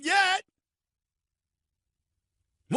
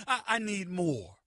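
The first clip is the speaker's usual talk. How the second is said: lower, softer, slow whiny plea; a hint of Fat Bastard begging; 7 semitones lower, 5 dB quieter than usual. Fat Bastard asking the Rams for more, low slow whine. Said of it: "Fat Bastard talking about".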